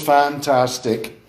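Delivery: slow, syllable by syllable fantastic; syllables spelled out. Shown as a demonstration. Lecturer sounding out the word for students, slowly. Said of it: "Everyone can say that there's three syllables".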